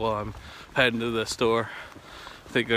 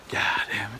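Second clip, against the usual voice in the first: muttered curse; 2 semitones lower than usual. Real, not acted. Tired trudge home in snowstorm.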